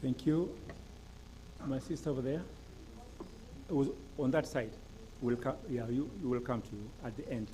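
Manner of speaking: ordinary talk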